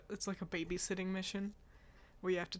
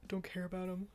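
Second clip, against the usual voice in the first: talking through a yawn; 4 dB quieter than usual. Real, not acted. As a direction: say it mid-yawn, mouth stretched. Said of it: "Sorry, I'm yawning".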